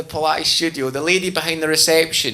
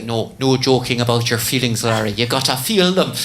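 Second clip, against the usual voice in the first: put-on Northern Irish accent; 5 semitones lower than usual. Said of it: "And she's Northern Irish".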